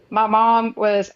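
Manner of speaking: normal talking voice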